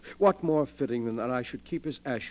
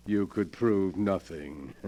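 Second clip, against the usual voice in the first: ordinary talk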